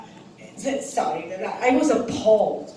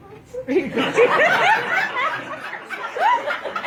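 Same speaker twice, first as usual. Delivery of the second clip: long rising high laughter; laughing hard at a silly question; high laughing fit. Real, not acted.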